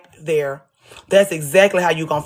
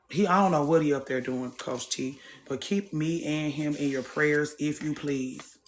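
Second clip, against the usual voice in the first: much softer, low muttered aside; quiet aside; 5 semitones lower, 8 dB quieter than usual. Real, not acted. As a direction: drop the voice, talking off to the side. Aside while distracted, speaking low.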